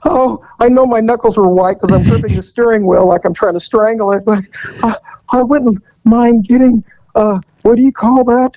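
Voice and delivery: high, jittery mock panic; nervous Nelly. Nervous customer at the car window, high jittery mock panic. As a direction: babble like a terrified first-timer. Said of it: "here's the nervous Nelly".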